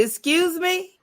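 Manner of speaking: sharp, offended excuse me; a hint of disbelief; mock affront at the guard; indignant.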